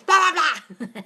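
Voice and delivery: loud high laughing blah blah; mock shouting. Shown as a demonstration. Showing viewers how she sounds when shouting, half laughing. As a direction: ham up her own shouting. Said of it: "when I'm going".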